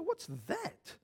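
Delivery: high-pitched, softened mock alarm; startled reaction. Preacher retells opening an unexpected attachment, startled. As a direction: act out a puzzled double take, pitched high. Acts out seeing surprise attachment.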